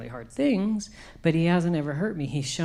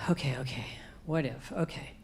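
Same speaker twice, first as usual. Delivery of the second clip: fading, hushed okays; 8 dB quieter than usual. Retold or imitated. Working up courage, fading.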